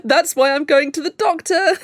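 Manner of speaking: giggling through the words; laughing. Real, not acted. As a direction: laugh as you confess. Laughing at own confession.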